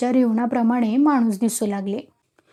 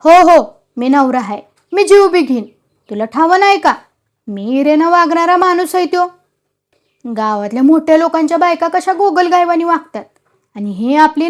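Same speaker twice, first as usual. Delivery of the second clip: louder, higher defiant husband's rant; glaring anger; 5 semitones higher, 5 dB louder than usual. A narrator's reading. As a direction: spit it at his mother, glaring. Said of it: "डोळे वटारून सांगितले". (डोळे वटारून रागाने बोलणे)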